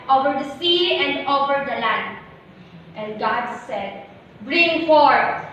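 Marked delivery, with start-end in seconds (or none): 4.4-5.4 raised, ringing command. Acted. God calling creatures forth over sea and land, commanding. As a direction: call it out loud and high.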